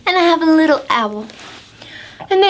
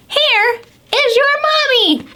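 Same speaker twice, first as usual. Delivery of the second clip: squirrel; 13 semitones higher than usual. Acted. Squirrel presenting the bear as mommy, high and eager.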